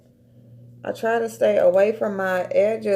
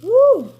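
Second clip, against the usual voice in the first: loud, high-pitched whoop; 14 semitones higher, 8 dB louder than usual. Real, not acted. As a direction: let out a sharp woo. Reacting to the mask sting.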